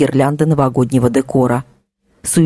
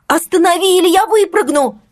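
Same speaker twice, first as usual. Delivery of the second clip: frantic scream. Acted. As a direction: scream it in a panic. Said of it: "истошно закричала Ксюша". (истошный крик)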